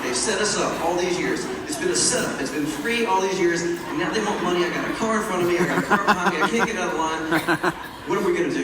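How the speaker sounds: loud, worked-up fatherly tirade; dad's ranting speech. Retold or imitated. Dad ranting to his boys in the stuck car, loud indignant speech. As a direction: rant like an exasperated dad stuck in traffic. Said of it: "He starts giving us a speech".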